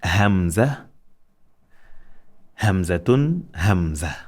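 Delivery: Hamza, Hamzatun rising, Hamza falling; teacher modelling the Arabic letter Hamza; letter name drill. Shown as a demonstration. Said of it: "One more time".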